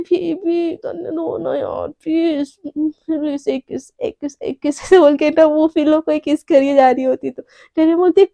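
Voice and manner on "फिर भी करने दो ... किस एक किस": sleepy pleading. Acted. Sleepy girl begging to kiss pillow.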